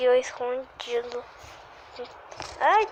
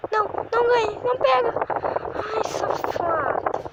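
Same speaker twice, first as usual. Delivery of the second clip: frustrated whine; 7 semitones higher than usual. Real, not acted. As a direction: squeak out the loss, annoyed. Losing the round, frustrated.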